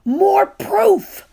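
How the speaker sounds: high, forceful loud whisper. A narrator's reading. Boy giving a loud whisper over the bags. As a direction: hiss it eagerly but loud. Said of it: "said Gary in a loud whisper".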